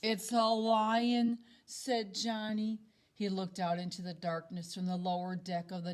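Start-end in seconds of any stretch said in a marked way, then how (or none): 0.0-1.4 slow, loud announcement. Acted. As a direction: declare it slowly and loudly. Boy hears noise at night.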